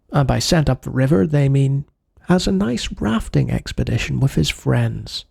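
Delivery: ordinary talk, nothing marked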